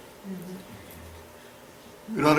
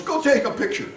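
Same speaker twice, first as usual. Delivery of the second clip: much higher, urging quote of his wife; wife telling him to go take a picture, voiced high; quoting his wife; 12 semitones higher than usual. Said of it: "She goes".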